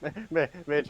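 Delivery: normal talking voice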